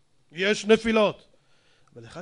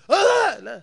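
high, louder scoffing; mock angry retort; 11 semitones higher, 9 dB louder, slower than usual. Rabbi imitates the angry man barking no, higher and louder. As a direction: bark a dismissive no.

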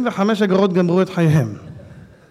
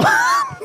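high laugh. Real, not acted. Laughing at his own joke.

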